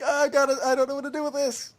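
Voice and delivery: high-pitched fretting at normal volume; mock flustered helplessness. Boy at a loss before his sister, mock flustered.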